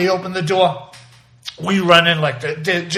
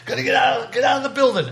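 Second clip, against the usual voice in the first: retold urgent yell; 8 semitones higher than usual. Teachers rushing in to clear the classroom, retold urgent yell. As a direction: imitate a panicked order to evacuate.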